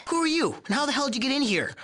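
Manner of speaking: high and fast, caught off guard; sudden alarmed challenge; startled. Acted. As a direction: yelp at an intruder. Startled by intruder.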